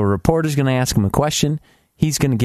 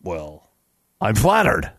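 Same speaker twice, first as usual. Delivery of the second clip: normal talking voice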